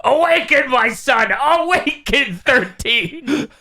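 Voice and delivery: high-pitched mad-doctor scream, held back in volume; hammed-up villain screaming to raise his creation; mock scream. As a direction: cry it out high, drawing out the last command. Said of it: "cybercomputer Gero just screams".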